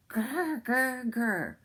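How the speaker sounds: high mock tiger growl, rolled r. A narrator's reading. The tigers can only growl at Sambo, a mock growl. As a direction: growl like a tiger stuck holding tails. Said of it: "they could only say".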